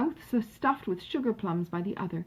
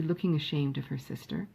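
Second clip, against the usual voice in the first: usual speaking voice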